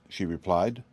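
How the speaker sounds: usual speaking voice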